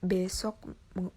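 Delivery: ordinary talk, nothing marked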